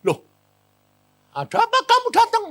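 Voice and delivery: high, loud, hurried question; startled. Retold or imitated. Preacher startled by his friend's spirit at prayers, high and surprised. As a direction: blurt it out in acted shock. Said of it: "Loh, kaget".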